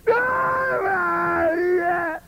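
high crying wail of an overdone prayer; over-the-top tearful "padre" cry; mock wailing prayer. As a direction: ham up a pastor's theatrical sobbing prayer. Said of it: "¿Por qué le hablan así al Señor?".

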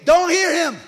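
loud, high hammed-up plea; mock shout. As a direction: beg God loudly not to listen. El predicador pide a Dios. (el predicador le grita a Dios que no oiga)

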